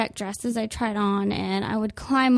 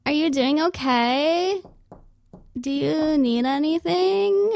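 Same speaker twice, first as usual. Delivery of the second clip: slow, higher sing-song clerk voice; chirpy clerk; 5 semitones higher, slower than usual. Retold or imitated. Clerk knocking on the dressing room door, higher and sing-song. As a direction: imitate the hovering shop assistant. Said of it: "they're like".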